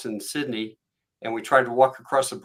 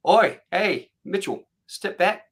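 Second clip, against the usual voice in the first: louder, higher urgent call, acted; warning call; 7 semitones higher, 4 dB louder, slower than usual. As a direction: call him back from a snake. Cousin warns of deadly snake.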